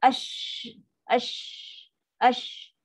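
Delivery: "ash" hissed with lips pushed forward; wrong pursed ash. Shown as a demonstration. Teacher shows students the wrong pursed "ash". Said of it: "Bukan ash".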